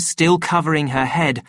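usual speaking voice

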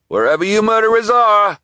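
high, loud taunting call; delivery man calling to hidden kids. Acted. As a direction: yell it through the store. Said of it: "he called".

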